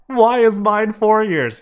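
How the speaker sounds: high, loud, drawn-out complaint; indignant protest. Acted. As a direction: protest it loudly, voice shooting up. Indignant protest in read story.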